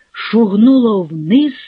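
ordinary delivery, nothing marked

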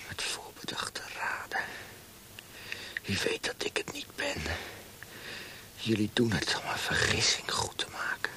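whispered, barely voiced protest; frightened whisper. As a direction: whisper it in terror. Terrified prisoner whispers.

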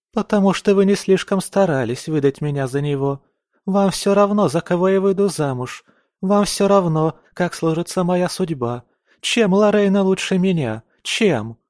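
high-pitched, indignant reproach; mildly hammed-up aggrieved daughter; accusing outburst. A narrator's reading. Daughter accuses mother in quarrel.

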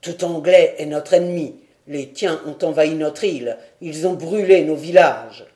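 loud, accusing warrior's voice; hostile chief. Acted. Karatete denounces the English prisoners, loud and hostile. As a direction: hurl the charge at the captives.

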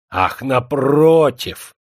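loud, slow, drawn-out exclamation; exclaiming. A narrator's reading. Demidov protests eagerly to the girl's father, loud exclamation. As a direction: cry it out eagerly. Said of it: "вскричал Демидов".

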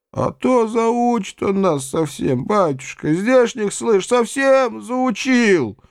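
slow, high plaintive cries; high, stretched-out lament; wailing peasant complaint. A narrator's reading. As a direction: draw out the grievance in a high wail. Peasants wailing their complaint together.

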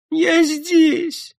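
very high whiny female voice; плаксиво. A narrator's reading. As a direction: answer in a tearful squeal. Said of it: "плаксиво отозвалась Шура". (плаксивый писк)

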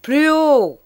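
loud, high teacher's shout; teacher shouting at a mumbling pupil; shouted order. Acted. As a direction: yell it across the classroom. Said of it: "cria le maître".

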